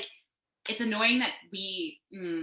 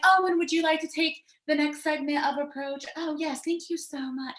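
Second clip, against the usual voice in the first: put-on high, prim British accent, like a polite host; mock-posh British host handing over the mic; exaggerated British accent, much higher than her own; 8 semitones higher, 4 dB louder than usual. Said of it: "I don't know why I got a British accent".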